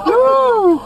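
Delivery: loud high whoop of relief. Real, not acted. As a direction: let out a big whoop. Relief after the chase.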